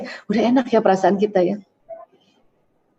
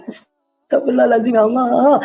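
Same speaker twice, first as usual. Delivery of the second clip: sighing istighfar. Shown as a demonstration. Demonstrating a sighing prayer.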